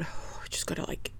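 a soft whisper to herself; whispered thought. Retold or imitated. Alone in the bathroom, whispering to herself. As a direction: breathe it out, barely voiced.

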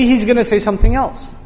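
ordinary talk

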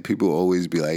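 usual speaking voice

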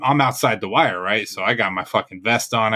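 usual speaking voice

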